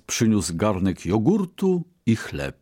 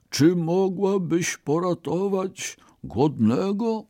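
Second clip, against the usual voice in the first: much higher, slow quavering beggar plea; weak trembling old man; 10 semitones higher than usual. A narrator's reading. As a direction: beg feebly like a starving old man. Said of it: "słabym i drżącym głosem starca".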